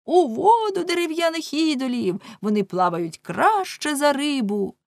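high jeering shout about idols; mock the statues as you yell; rabble yelling. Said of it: "невідомі кричали". (натовп глузливо кричить про ідолів)

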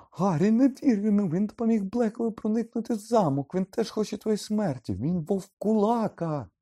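frantic high, loud warning; shrieking. A narrator's reading. Hermione warns Harry that Lupin is a werewolf, shrieking. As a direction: scream it, stretching out the final word. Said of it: "заверещала Герміона".

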